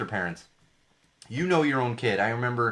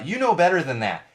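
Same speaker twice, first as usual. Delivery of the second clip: higher, louder scolding; mock stern parent; 6 semitones higher, 7 dB louder than usual. Parent scolding a child who misbehaved, stern. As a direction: scold as if they should know.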